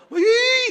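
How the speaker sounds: high, loud ghostly wail; eerie high wail. Retold or imitated. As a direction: imitate a spooky sound in the dark. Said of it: "이런 소리가 들리는 것 같아". (높고 큰 귀신 소리 흉내)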